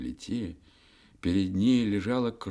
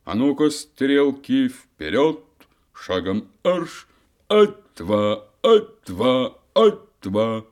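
loud marching drill command; 7 semitones higher, 11 dB louder, slower than usual. Acted. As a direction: bark it like a drum major. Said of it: "скомандовал".

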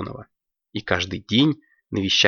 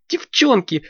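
put-on high, bright girlish Девчонки with a falling end; excited girl voice; high girl's call; 12 semitones higher, 3 dB louder than usual. Алёнка calls friends.

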